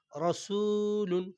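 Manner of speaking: slow recited রসুলুন with long vowels; chanted রসুলুন; drawn-out recitation. Shown as a demonstration. Quran reading drawn out.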